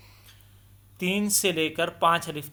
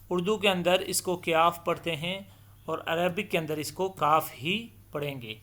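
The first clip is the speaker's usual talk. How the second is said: ordinary talk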